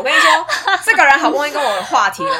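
laughing excitedly. Real, not acted.

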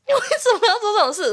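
shrill disbelieving outburst; outraged high exclaim. Real, not acted. Outraged retelling of a creepy guy.

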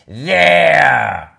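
a proud triumphant shout. Acted. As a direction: crow it from the top. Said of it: "shouted Pig".

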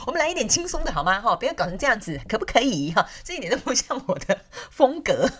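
又高又响的俏皮语气; 活泼地提高嗓门带动气氛. Real, not acted. (兴奋高亢)

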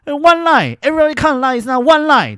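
high, loud furious yelling. Retold or imitated. Scolding the staff over the queue, loud high yell. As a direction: bellow it at the staff. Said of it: "我就超大声那个地方大吼".

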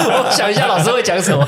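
talking through laughter, voice up high; laughing. Real, not acted. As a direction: giggle while admitting you're stuck. Laughing at own blank mind.

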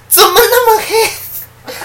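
high, loud, slow shocked cry through a laugh; a startled auntie gasping at the sight; high loud stretched-out shock. Retold or imitated.

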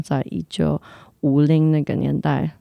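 ordinary talk, nothing marked

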